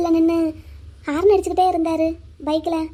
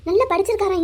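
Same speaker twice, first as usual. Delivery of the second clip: usual speaking voice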